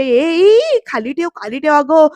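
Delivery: high drawn-out mock yell, then eases; acted shriek. Shobha orders the strange doll put down, acted high shriek. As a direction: squeal in alarm, a storyteller's imitation. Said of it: "शोभा ओरडते म्हणते".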